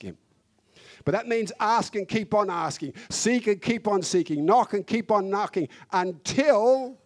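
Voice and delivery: rising, driving sermon voice, slowing on the repeats; fervent pulpit preaching, stretched and emphatic; full-force preaching. Acted. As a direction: preach it hard, pleading with the congregation. Preaching on asking God.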